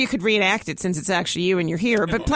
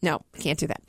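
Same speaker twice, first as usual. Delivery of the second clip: usual speaking voice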